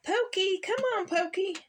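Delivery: high calling to her brother. A narrator's reading. Sister Pickles calling Pokey home, high-pitched call. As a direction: call him back across the woods. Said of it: "Pickles call".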